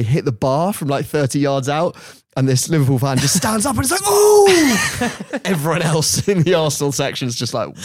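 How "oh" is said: loud, high crowd "ooh"; long high-pitched terrace cry; fan's big "ooh". Retold or imitated. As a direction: leap up and howl at a near miss. Fan leaps up at near miss.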